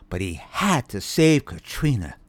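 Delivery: usual speaking voice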